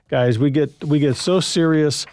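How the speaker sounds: normal talking voice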